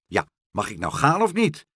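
loud, high interruption; impatient. A narrator's reading. Karel interrupts meneer Pen's instructions, impatient. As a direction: cut in, itching to leave. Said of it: "riep Karel ongeduldig".

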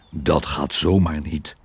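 low, threatening dog voice; low-voiced quiet menace; menacing. A narrator's reading. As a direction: warn sternly, pitched well down. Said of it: "zei hij dreigend".

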